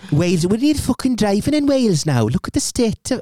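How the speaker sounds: high, singsong mock Welsh; attempted Welsh accent. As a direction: have a shaky go at Welsh. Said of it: "Can you do Welsh?".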